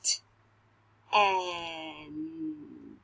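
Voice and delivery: T and and said apart; sounding out words. Shown as a demonstration. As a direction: sound out each piece. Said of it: "Say them".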